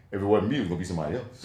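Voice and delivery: Chris's delivery. Retold or imitated. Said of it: "just how he said it".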